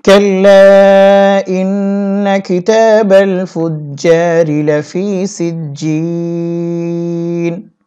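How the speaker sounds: slow melodic tilawat, each vowel drawn out; Quran recitation. Shown as a demonstration. Teacher reciting the verse to students in slow tilawat. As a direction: chant it like a reciter, slow and sung. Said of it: "teacher recites the verse".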